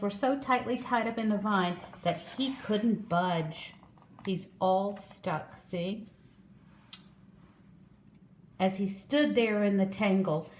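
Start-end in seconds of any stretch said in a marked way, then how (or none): none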